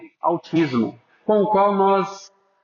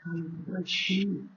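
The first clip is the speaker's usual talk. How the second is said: very soft, discreet murmur; hushed, discreet; 13 dB quieter than usual. Shown as a demonstration. Preacher showing the congregation how to talk during worship, hushed. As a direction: say it very softly, as an example. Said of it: "seja discreto".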